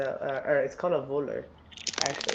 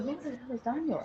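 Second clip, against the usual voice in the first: much higher startled question; alarmed mom; 10 semitones higher than usual. Retold or imitated. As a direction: ask it like a shaken mother. Retold alarmed mother.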